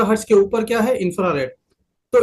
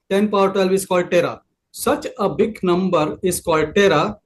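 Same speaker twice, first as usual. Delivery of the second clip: slow, deliberate explanation; slow teaching pace; much slower than usual. Shown as a demonstration. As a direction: spell it out slowly for students. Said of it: "teacher defining tera slowly".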